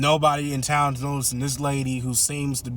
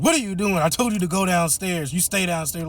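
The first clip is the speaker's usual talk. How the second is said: louder, higher scolding; mock angry scolding; 6 semitones higher, 3 dB louder than usual. Ma catches the teens upstairs, scolding in mock anger. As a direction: tell them off as if caught.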